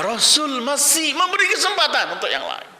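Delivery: high, ringing sermon delivery; impassioned preaching. Acted. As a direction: build the suspense at full preaching pitch. Preaches at full force. (khotbah lantang penuh semangat)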